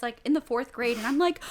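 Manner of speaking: ordinary talk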